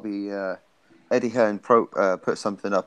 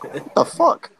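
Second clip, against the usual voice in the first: incredulous; 5 semitones higher than usual. Retold or imitated. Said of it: "it's like".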